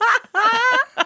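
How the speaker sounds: shrill burst of laughter; high squealing laugh. Real, not acted. Laughs at own joke.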